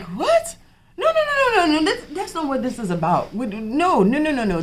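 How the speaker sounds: very high, loud retold disbelief; shocked protest. Patient rejecting a Zoloft prescription, high-pitched alarmed protest. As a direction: squeal in disbelief, then rattle off the refusals, easing down.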